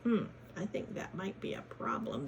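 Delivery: ordinary delivery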